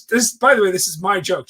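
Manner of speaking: normal talking voice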